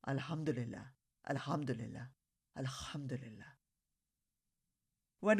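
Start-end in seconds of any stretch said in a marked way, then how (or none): 0.1-3.6 softly murmured prayer, fading to almost nothing; soft whisper. A narrator's reading. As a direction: breathe the thanks gently, quieter each time. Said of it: "Abdullah softly whispered".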